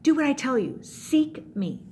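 lightly acted God giving firm orders; stern God. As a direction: lay down a firm condition. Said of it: "And so he says".

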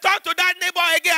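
high shouted preacher command. Retold or imitated. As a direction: bellow it like a fired-up pastor. Pastor shouting in story.

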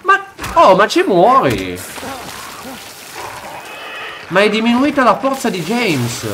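high, loud dismayed cry; alarmed outcry. Real, not acted. Player dying in the game, loud alarmed outcry. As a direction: yelp as the character dies. Dying in quick time event.

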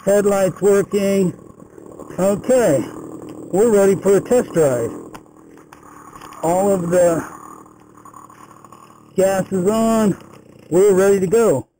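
muffled Bluetooth helmet mic; speaking inside a closed helmet. Real, not acted. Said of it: "I'm gonna be wearing a enclosed helmet".

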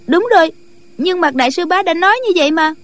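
high, quick disciple voice; protesting young disciple. Acted. Junior disciple defends herself to her elder sister, high-pitched.

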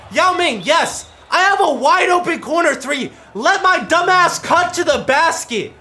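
high, loud scolding; exasperated yelling. Real, not acted. Gamer scolding Yao Ming over a blown play, angry yelling.

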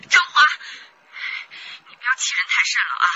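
loud, furious warning; angry shout. Acted. Wife yells at her husband over the phone, furious. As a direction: shout him down.

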